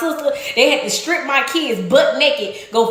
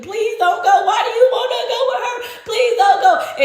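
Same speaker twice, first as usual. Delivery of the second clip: mock pleading; 10 semitones higher than usual. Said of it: "she's crying, she's begging him, pleading".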